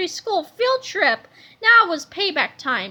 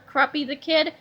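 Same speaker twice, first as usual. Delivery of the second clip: plain reading voice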